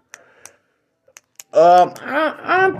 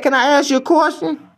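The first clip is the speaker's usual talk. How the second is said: customer voice; 9 semitones higher than usual. Acted. Customer voice punchline setup.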